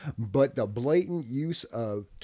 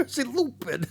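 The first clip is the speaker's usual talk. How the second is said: Zarko impression; 11 semitones higher than usual. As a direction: pitch it way up and holler it. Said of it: "what does Zarko say".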